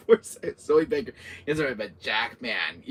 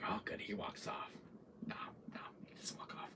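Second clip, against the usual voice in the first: hushed acted relief turning to doubt; very soft muttering to yourself; hiding whisper; 7 semitones lower, 20 dB quieter than usual. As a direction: whisper it so he won't hear. Player's hushed hope while hiding.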